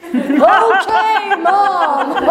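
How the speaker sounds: high, loud, laughing "Okay, Mom"; cheeky fake compliance through a laugh; mock obedient. Mocking reply to strict mother.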